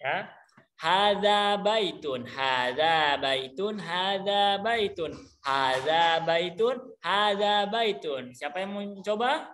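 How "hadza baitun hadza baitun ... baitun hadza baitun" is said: slow, deliberate model for repeating; slow drill recitation. Shown as a demonstration. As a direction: spell it out slowly for the class. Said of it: "coba kita hafalkan". (ucapkan pelan-pelan untuk ditirukan)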